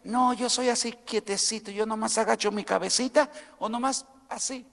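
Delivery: soft, meek mock voice of a shy churchgoer; preacher mocking a meek worshipper who never moves; mocking timid believer. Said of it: "la persona dice".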